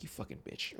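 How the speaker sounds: whispered, under the breath; mock reaction muttered without voice; whispered insult. Host relives reacting to the eye roll, whispered insult. Acting out own reaction to film.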